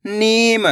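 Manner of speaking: नीम with the ee stretched long; नीम, long ee before the m; drawn-out long vowel. Shown as a demonstration. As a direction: pull out the ee in नीम. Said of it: "इसी तरीके से पढ़ना आपको खींच के".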